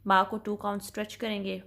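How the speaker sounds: ordinary talk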